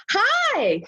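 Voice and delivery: bubbly American tourist, high pitched; American accent. Retold or imitated. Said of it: "this is my American accent".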